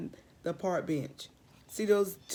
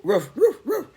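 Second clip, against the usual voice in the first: dog barking; 7 semitones higher, 10 dB louder than usual. Acted.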